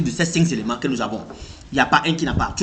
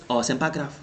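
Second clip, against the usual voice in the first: calm shrug-off; 9 dB quieter than usual. Acted. Acting calm when insulted.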